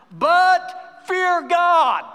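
"But fear God" shouted high and loud, every word stretched out slowly; slow shouted emphasis. Shown as a demonstration. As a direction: hammer out "fear God" like a pulpit cry. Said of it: "watch this right here".

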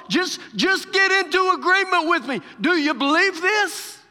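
high, loud urging of a doubter; preacher acting out an urgent appeal; impassioned preaching. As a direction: plead insistently from the pulpit. Preaches as Jesus urging Martha.

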